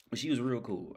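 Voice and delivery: normal talking voice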